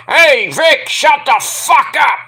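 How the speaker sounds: high, loud, slow put-down shout; mock rude yell. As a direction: bark it at the announcer. Toxic Attraction interrupts the commentators.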